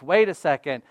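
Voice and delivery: usual speaking voice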